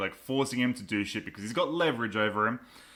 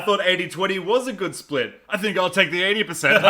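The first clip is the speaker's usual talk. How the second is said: smug Whitaker; 6 semitones higher, 6 dB louder than usual. Retold or imitated. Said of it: "He's like".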